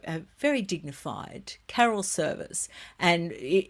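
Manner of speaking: normal talking voice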